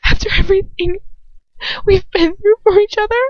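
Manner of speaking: high, loud, trembling plea; a girl's voice close to tears. A narrator's reading. Girl confronting her lover, high and trembling. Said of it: "a trembling flutter of a whisper".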